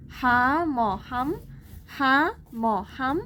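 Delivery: very slow, हा म हम split syllable by syllable; sounded out slowly. Shown as a demonstration. Teacher to students, breaking the word apart very slowly. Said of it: "तोड़ तोड़ के पढ़ेंगे".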